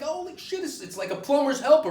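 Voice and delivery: high-pitched alarmed outburst; comic panic. Acted. Patient reacting to doctor's huge instrument, comic panic. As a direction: yelp it in mock horror.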